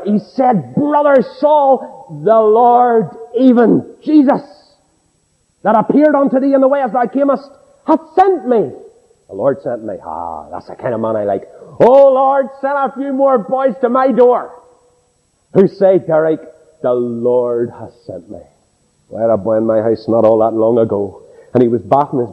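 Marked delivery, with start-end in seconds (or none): 0.7-8.7 solemn proclamation. Acted. Ananias proclaims in King James words. 11.8-18.4 fervent prayer. Acted. Preacher prays aloud for more men.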